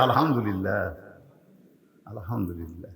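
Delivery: low, soft murmur, fading further; hushed thanksgiving. Acted. Ali thanking God for his son's answer, hushed murmur. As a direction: breathe out the praise quietly and low.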